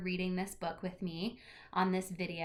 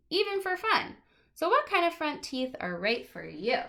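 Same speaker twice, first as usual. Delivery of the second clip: lively picture-book read-aloud, louder than her chat; reading to children; 2 semitones higher, 5 dB louder than usual. Acted. Teacher reading a teeth book to her class, lively read-aloud. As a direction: read it out brightly to a class of kids.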